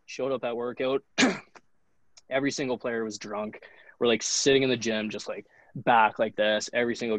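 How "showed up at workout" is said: usual speaking voice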